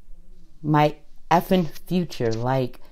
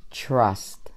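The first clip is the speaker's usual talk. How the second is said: soft whispered word; whispering; 5 semitones lower, 7 dB quieter than usual. Real, not acted. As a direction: breathe the word out.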